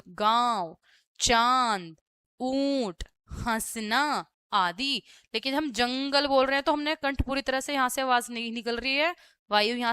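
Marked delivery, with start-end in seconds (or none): 0.1-4.2 "गाँव, चांद, ऊंट, हंसना" drawn out through throat and nose; nasalised vowels. Shown as a demonstration. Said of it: "गले से और नाक से भी". ("गाँव, चांद, ऊंट, हंसना" गले और नाक से खींचकर)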